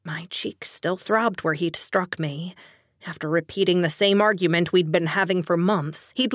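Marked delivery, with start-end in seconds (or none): none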